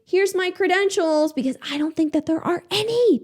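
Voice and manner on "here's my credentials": mock injector. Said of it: "I don't see one, like".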